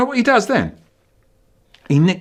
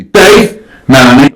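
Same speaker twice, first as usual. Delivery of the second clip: loud calling; 13 dB louder than usual. Pretend. Calling names at fossil.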